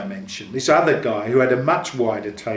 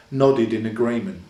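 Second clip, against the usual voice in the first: plain reading voice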